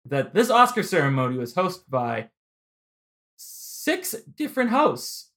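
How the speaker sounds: ordinary delivery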